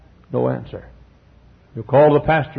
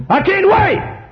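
much higher, louder exultant cry; gleeful outburst; 4 dB louder, slower than usual. Acted. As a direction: burst out with eager glee.

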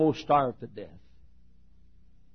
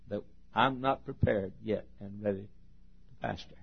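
softer, much quieter, almost breaking; tearful confession; 9 semitones lower, 14 dB quieter than usual. Retold or imitated. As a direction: admit it with your voice fading. Student confessing unreadiness, tearful.

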